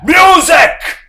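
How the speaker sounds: high, loud barked order; commanding shout. A narrator's reading. Harrison ordering the musicians to play, shouting. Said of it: "he commanded".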